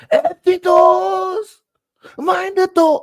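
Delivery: shrill high yell. Pretend. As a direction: call out high and stretched. Mock yelling at empty doors.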